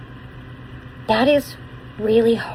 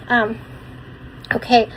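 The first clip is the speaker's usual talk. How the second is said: ordinary delivery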